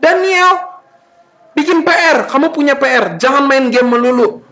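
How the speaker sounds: high, insistent scolding; nagging parent. Acted. Parent orders child off games to homework, nagging. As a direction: nag the child about homework. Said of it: "suka kayak begini".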